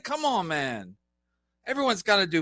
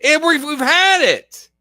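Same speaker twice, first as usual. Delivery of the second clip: loud, higher exasperated yell; fed-up shout; 7 semitones higher, 8 dB louder than usual. Real, not acted. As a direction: cut him off, you've had enough. Interrupts, fed up.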